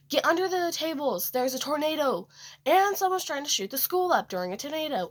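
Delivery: teacher yelling warnings at the students, high and urgent; retold teacher's yell. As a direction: bark it out like a frightened teacher. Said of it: "the teacher ... yells".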